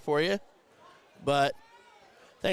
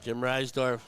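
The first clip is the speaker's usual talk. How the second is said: normal talking voice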